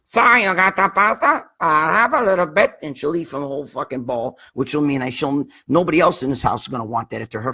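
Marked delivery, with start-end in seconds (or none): 0.0-2.7 high, louder, whimpering put-on voice; mimicking the girlfriend's mother as she begs, in a high, louder whimpering voice; mocking girlfriend's mother. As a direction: play her as a pitiful, whiny moocher begging for pasta. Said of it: "She, she talks like this".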